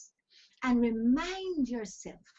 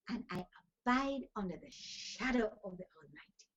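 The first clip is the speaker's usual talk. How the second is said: normal talking voice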